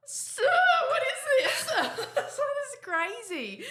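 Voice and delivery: high laughing what the hell, slowed; laughing disbelief. Real, not acted. Friend laughing at discovering her famous. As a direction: squeal it through real laughter.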